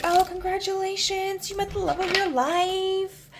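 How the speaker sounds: very high, slow, sugary gush; gushing congratulations. Retold or imitated. American woman gushing to her newly married German friend. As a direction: coo it like a starry-eyed friend.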